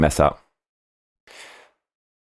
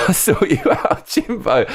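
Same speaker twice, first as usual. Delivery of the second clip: reading the comment aloud through a burst of laughter, pitched higher; laughing while reading; 10 semitones higher than usual. Real, not acted. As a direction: crack up mid-line, then push through the words.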